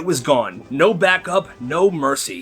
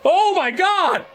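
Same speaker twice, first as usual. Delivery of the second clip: very high, drawn-out panicked cry; hammed-up high panic; panicked scream; 13 semitones higher, slower than usual. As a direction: cry out long and high in mock horror. Senior kid sees bees escaping.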